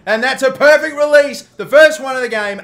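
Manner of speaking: loud, high celebratory call; excited shout. Real, not acted. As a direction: shout it as the shot drops. Celebrating a perfect shot release.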